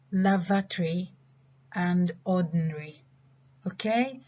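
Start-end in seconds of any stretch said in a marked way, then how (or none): 0.1-1.1 LAVATORY squeezed to "LAV-uh-tree"; reduced pronunciation. Shown as a demonstration. Teacher reviewing reduced words with students, reduced pronunciation. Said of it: "So we reduce this again". 2.2-3.0 ORDINARY squeezed to "ORD-n-ree"; reduced pronunciation. Shown as a demonstration. Teacher ending the review list, reduced pronunciation. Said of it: "So we reduce this again".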